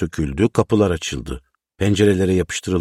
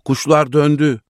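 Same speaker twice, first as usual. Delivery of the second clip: high excited call, mildly raised; joyful shout; 7 semitones higher, 3 dB louder than usual. A narrator's reading. Tahir running home with the news, joyful mild shout. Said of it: "Bağıra bağıra koşuyordu".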